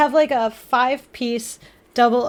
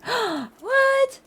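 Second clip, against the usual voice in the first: a sharp gasp, then a high What?; surprised gasp; 11 semitones higher, 5 dB quieter than usual. Real, not acted. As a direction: caught completely off guard. Surprised by a gift.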